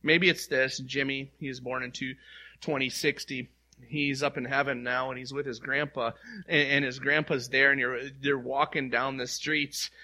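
usual speaking voice

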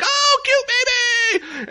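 very high, slow, loud coo; squealing baby talk; gushing over a baby. Retold or imitated. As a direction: gush like you just saw the cutest baby. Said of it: "She'd be like".

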